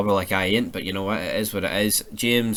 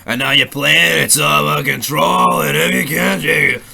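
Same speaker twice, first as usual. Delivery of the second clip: slow, loud wrestler growl of a promo; menacing heel wrestler boasting slowly and loudly; Triple H impression; 4 semitones higher, 8 dB louder than usual. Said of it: "Triple H style".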